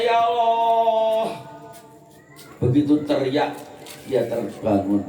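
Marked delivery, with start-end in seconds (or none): 0.0-1.4 high drawn-out wail, sung; crying out to God. Acted. Parched man cries out to God in despair, drawn out. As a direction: cry out long and high to Allah. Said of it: "orang itu teriak".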